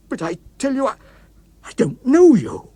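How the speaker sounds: plead, stumbling in fear; frightened protest. Acted. Walter backing away from the murderer, frightened stammer. Walter terrified by his creation.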